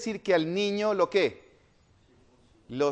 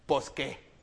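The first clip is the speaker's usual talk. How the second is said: put-on northern Mexican accent; 5 semitones lower, 5 dB quieter, much slower than usual. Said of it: "en lugar de decir pues".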